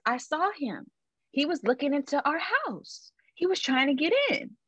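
high, scared telling; a kid reporting the man she saw, high and shaken; frightened sister. Retold or imitated. Sister reporting the man at the window, high and scared. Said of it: "And so she finally says".

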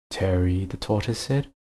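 plain reading voice

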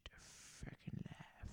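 breathy near-silent whisper; whispered curse. Acted. Host whispers the curse almost silently.

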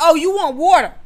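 high, loud, emphatic exclamation; exaggerated guessing. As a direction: announce the guess loudly to provoke an answer. Retold goading child with wrong guess.

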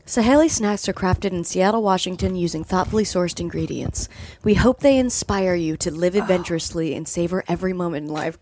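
low, mock-earnest package reading; reading label text. Real, not acted. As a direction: read it like ad copy. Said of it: "I just wanna read these, the back of this cashew thing".

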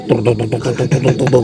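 soft, rapid repeated "tuk" sounds; imitated putt-putt noise. Guessing what the noise was, soft imitated puttering. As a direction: mimic a small motor puttering softly. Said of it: "Suara poging".

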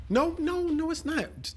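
excited protest. Real, not acted. Friend protesting that only paid help changes diapers. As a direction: jump in to shoot the idea down.

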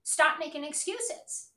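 ordinary talk

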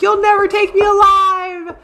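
hooting mock yelps of defiance; imitating a hollering man. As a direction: ham up the panicked guy resisting arrest, slowly. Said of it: "hooting and hollering, being like".

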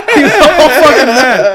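slow, loud and high through laughter; can barely get it out for laughing. Real, not acted. Laughing while retelling.

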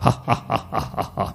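long, airy spirit's laugh fading out; ghostly breathy laugh. Retold or imitated. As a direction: laugh like an unseen ghost mocking them. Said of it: "Kemudian suara tu ketawa". (ketawa hantu berbisik, panjang dan menyeramkan)

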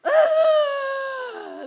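high loud wail; high loud tearful wail; crying out. Retold or imitated. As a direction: burst out in a high loud cry. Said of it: "And I was just".

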